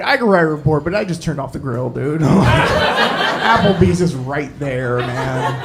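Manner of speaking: low, grudging brush-off; reluctant server. Retold or imitated. Cop like a server at closing, lowly reluctant. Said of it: "He said it like I walked into T G I Fridays".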